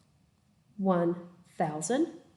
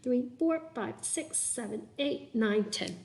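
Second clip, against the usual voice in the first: quiet, careful count; soft counting; 7 dB quieter, slower than usual. Real, not acted. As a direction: count under your breath. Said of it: "let me count it".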